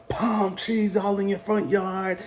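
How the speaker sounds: high, slow, drawn-out rant in imitation; mock going on. Commander rants about California front yards, mock going on. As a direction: stretch it out in a put-on high commander voice. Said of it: "He just going on".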